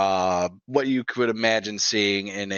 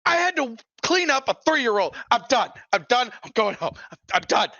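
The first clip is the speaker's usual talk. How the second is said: high-pitched, exasperated rant; fed-up outburst; 13 semitones higher, faster than usual. Acted. Fed-up officer quitting on the spot, exasperated. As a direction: at the end of your rope, voice way up.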